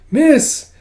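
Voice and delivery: high, loud, rising cry; urgent calling out. Acted. Kippa helps her friend sit up, urgent cry. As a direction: call out to her at a high pitch.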